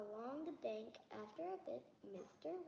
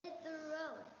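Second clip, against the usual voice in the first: louder read-aloud for the listener; 3 semitones higher, 5 dB louder than usual. Acted. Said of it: "Louder, little louder".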